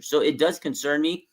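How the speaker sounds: ordinary delivery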